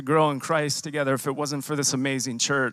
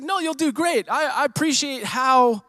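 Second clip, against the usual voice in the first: imitating Ernie in a much higher, lifted voice; quoting Ernie; 10 semitones higher than usual. Ernie encouraging the nervous speaker, voiced much higher. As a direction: play a friend cheering him on, pitched way up. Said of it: "he's like".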